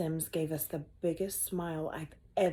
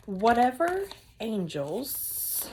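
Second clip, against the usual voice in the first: slow dramatic pause; 4 semitones higher, 5 dB louder, much slower than usual. Acted.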